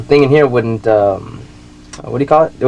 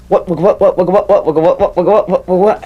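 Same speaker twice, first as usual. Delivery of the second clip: higher, quick what what what stutter; rapid vocal scratch; 8 semitones higher than usual. Retold or imitated. Mike showing off his scratch, rapid higher stutter. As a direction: fire off the scratch with the voice. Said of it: "he gets on there and goes".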